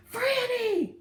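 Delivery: very high, loud shout; top-of-lungs yell. Acted. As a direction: yell the name across open land. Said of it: "yelled at the top of his lungs".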